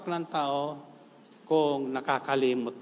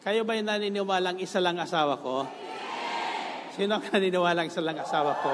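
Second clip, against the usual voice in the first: louder and higher, laughing at the crowd; laughing tease; 4 semitones higher, 3 dB louder, faster than usual. Real, not acted. Teasing question to congregation. (tumatawang nagbibiro sa madla)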